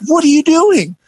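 very high, incredulous mock demand; mock outrage. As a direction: imitate guards confronting an intruder. Guards confront him backstage, retold.

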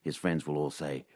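dry, deadpan storytelling read; comic story read. Acted. Host reading his scripted news-from-nowhere segment, deadpan. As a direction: read the absurd tale like a straight-faced report.

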